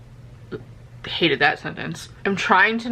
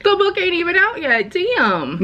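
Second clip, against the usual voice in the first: mock disbelief; 11 semitones higher than usual. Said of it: "I was like".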